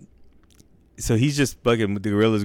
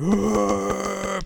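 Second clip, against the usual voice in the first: long high imitated gorilla roar; mock ape bellow, held long; 12 semitones higher than usual. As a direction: roar like a chest-pounding gorilla. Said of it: "the gorilla's up on top".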